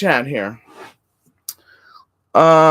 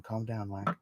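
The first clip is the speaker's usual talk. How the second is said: ordinary talk, nothing marked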